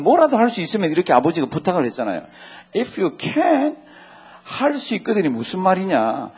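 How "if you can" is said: much higher, very slow "If you can"; stress on can. Retold or imitated. Preacher echoing the father, high slow "can".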